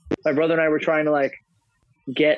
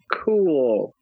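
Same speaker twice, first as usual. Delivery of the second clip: usual speaking voice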